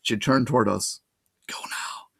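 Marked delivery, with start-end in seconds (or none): 1.5-2.1 urgent unvoiced whisper. A narrator's reading. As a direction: hiss the order under your breath. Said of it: "she ordered in the same tone".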